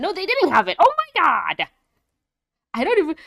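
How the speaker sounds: high, loud exasperated disbelief; almost yelling at the screen in disbelief; incredulous high-pitched yell; exasperated outburst. Real, not acted. Ranting at an episode flaw.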